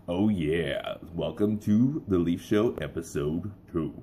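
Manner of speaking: slow, low smooth announcer voice; late-night radio host welcoming listeners; radio voiceover voice. Shown as a demonstration. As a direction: purr it like a cool radio ad. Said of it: "I like doing that voice".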